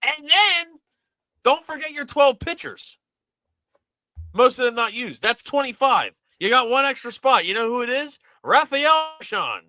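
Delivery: high-pitched mock-announcing disbelief; incredulous rant. Real, not acted. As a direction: count it off loudly like an absurd punchline. Exasperated mock roll-call of the roster.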